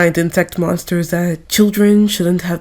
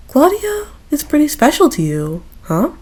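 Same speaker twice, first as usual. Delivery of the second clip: teasing; 8 semitones higher than usual. Acted. Teasing question to Riza.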